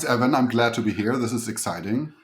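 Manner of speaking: ordinary talk, nothing marked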